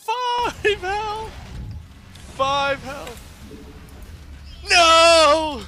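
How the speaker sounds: high frantic yelling; panicked shouting. Real, not acted. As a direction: cry out in alarm. Unit almost dead.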